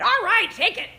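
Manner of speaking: loud, high, fed-up surrender; exasperated giving in. Acted. Worn-down parent caving to a whining child, loud and fed up. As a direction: throw up your hands and give in.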